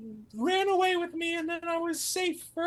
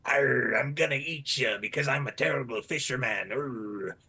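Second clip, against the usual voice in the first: very low, growly villain voice; terrible fisherman. Acted. Storyteller playing the fisherman who caught him, menacing growl. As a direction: growl it deep like a storybook brute. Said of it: "let me do the voice right".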